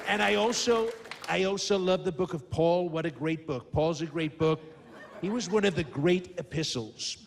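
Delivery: boastful American politician impression, drawing out key words; emphatic showman president with stretched superlatives; Donald Trump. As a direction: brag to the crowd in punchy, repeated phrases. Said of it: "now I've got Donald Trump".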